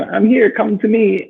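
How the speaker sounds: high, slow call to the universe; mock calling out. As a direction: beckon the money grandly. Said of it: "and I go".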